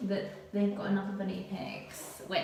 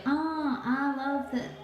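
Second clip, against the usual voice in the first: lightly voiced gushing client, higher pitch; a hint of a delighted salon customer; mock gushing customer; 5 semitones higher, slower than usual. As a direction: put on a higher, mildly gushy client voice. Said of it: "those people that's like".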